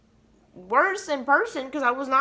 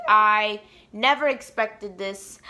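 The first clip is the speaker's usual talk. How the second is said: ordinary delivery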